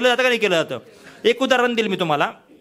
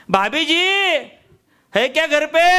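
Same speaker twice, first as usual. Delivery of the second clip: high hawker calling for the lady of the house; 6 semitones higher than usual. Retold or imitated. Hawker calling the housewife to the door. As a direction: call into the house. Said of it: "अचानक तो बाहेर आला".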